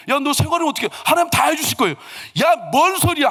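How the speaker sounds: high-pitched, exasperated scolding; retold angry outburst. Father-in-law challenging the suitor, exasperated. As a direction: mount to an indignant burst. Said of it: "화 버럭 내고".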